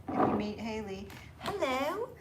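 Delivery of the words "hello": a bad imitation of a New Zealand accent, lifted and sweet; mock New Zealand shop welcome. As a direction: put on a clumsy New Zealand greeting. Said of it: "I'm so bad at the accent".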